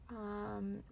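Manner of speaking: ordinary talk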